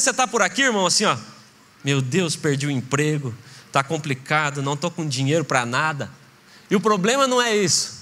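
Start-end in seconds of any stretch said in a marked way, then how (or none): 1.8-6.1 weary lament. Acted. Believer complaining after losing job.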